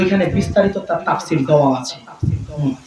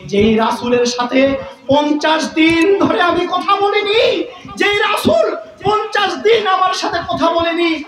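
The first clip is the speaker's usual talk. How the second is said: high, wailing sermon cry; chant-like, high-pitched preacher's delivery; impassioned preaching; 9 semitones higher than usual. Acted. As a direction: cry out the long silence with fervour. Preaches Kab's thoughts at full force.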